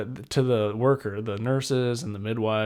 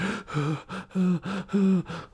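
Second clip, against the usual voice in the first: a long high gasp of disbelief; shocked gasp; 9 semitones higher than usual. Retold or imitated. Mother seeing her newborn on her chest, long high gasp. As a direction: gasp as if stunned.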